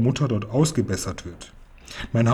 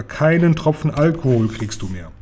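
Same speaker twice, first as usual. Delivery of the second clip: plain reading voice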